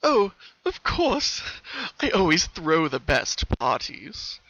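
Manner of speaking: slow, drawn-out sly promise; creepy colt. Acted. The colt reassuring the sisters, sly and slow. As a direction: savour it like a trap.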